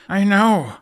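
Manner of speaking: high, loud, exasperated snap; aggravated. Acted. Hiccup snapping back at Toothless, aggravated. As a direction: throw it back at him, irritated. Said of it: "with a similar aggravation to his voice".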